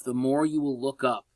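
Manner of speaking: ordinary talk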